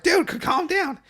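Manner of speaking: very high, urgent plea; calm your friend down fast; alarmed friend. Retold or imitated. Friend telling the boy to calm down, high and urgent.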